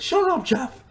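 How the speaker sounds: loud, high-pitched mock yell; imitated scream. Retelling a contestant's outburst mid-challenge, mock screaming. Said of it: "just fucking screams at the other".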